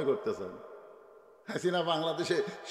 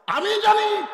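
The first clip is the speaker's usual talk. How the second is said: very high, loud cry; emphatic shout; 10 dB louder, slower than usual. Real, not acted. Preacher insisting he knows the history, emphatic shout. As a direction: belt out the claim to the crowd.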